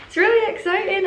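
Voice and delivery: high, thrilled lift; excited. Real, not acted. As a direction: squeal a little with excitement. Thrilled about the tag.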